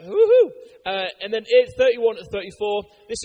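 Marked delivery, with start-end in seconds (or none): 0.1-0.5 high, loud whoop; excited cheer. Real, not acted. Preacher cheering that Jesus rose again, excited whoop. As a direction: whoop it up for the congregation.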